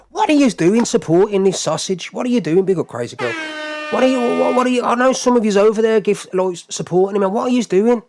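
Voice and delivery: loud, high-pitched disbelieving protest; exasperated outrage. Real, not acted. Streamer to people supporting the man who doxed, exasperated outrage.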